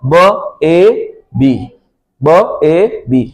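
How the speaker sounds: very slow, letter by letter; teacher slowly blending ब ए बी; slow sounding out. Shown as a demonstration. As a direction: spell out ब ए बी sound by sound. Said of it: "ए की आवाज क्या आ रही है".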